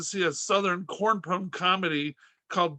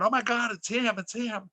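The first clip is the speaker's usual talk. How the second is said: lightly hammed-up excitement, starting higher; mock freaking out; 4 semitones higher than usual. Mall crowd spotting a celebrity, mock freaking out. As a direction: imitate a starstruck crowd, mildly. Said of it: "in the mall freaking out".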